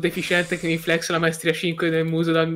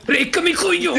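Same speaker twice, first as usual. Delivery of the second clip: high, loud call-out; excited shout; 13 semitones higher, 6 dB louder than usual. Real, not acted. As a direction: shout it at teammates. Calling out in fight.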